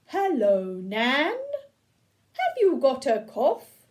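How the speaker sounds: a little robot politely asking Nan. Acted. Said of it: "and the robot said".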